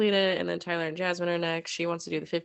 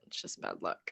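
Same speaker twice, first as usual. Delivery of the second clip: whisper; 9 dB quieter than usual. Real, not acted. Unvoiced aside.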